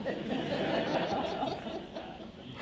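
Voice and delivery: breathy high laugh at the joke; laughing at his own punchline. Real, not acted. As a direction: let out a real laugh.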